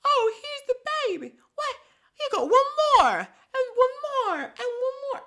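very high, loud laughing squeak; squeaky midwife voice. Retold or imitated. [name] delivering babies, excited high squeak. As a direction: deliver the babies in a giddy puppet voice. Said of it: "she's like".

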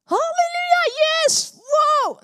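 hoog en luid halleluja-gejuich; juichend roepen. Retold or imitated. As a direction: roep het uit alsof er een wonder gebeurde. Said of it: "te juichen, te schreeuwen, te roepen". (luid en hoog jubelen)

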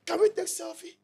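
high, gushing mock politeness; mock fawning fans. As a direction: fawn over the president, lightly sending them up. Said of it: "The same blacks were insulting him".

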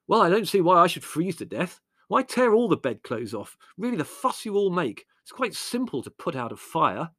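high-pitched, fussy complaint; indignant. A narrator's reading. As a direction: grumble at everyone for making a fuss. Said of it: "Larry sat up indignantly".

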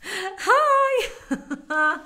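high, loud, laughing hello; excited greeting. Real, not acted. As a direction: burst in with a laughing hi. Popping into view, laughing.

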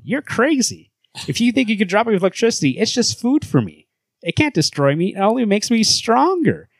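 high-pitched, worked-up hero voice; Superman. Acted. Hero boasting to Batman, high excitable voice. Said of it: "a higher voice for Superman".

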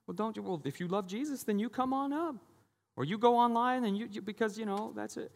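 high, mocking guilt-trip voice; mock pushy preacher. Pastor mocking a pushy preacher guilting givers, high mock voice. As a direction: imitate a pushy church guilt-tripper. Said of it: "maybe you heard these things in your past".

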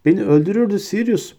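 high, wheedling rat-man voice; cringing Pettigrew plea. A narrator's reading. Pettigrew grovels to Hermione, high wheedling plea.

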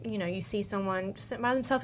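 ordinary talk

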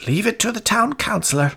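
high, fast echoing crowd voice; good people of Hameln echoing the council; townsfolk repeating. A narrator's reading. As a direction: echo it knowingly.

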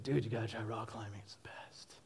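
hushed whisper, selling a secret; whispered friend quote. Retold or imitated. Friend in San Diego urging rock climbing, whispered. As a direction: lean in and whisper the tip.

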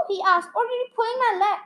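lively storytelling read aloud; animated children's story reading; story narration. A narrator's reading. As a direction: read it like a bedtime chapter book. Reads a story chapter aloud.

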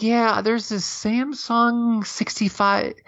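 high, slow, lightly voiced imitation of his wife; wife pitching a TV, mimicked high and slow; mock wife voice. Retelling wife's TV pitch.

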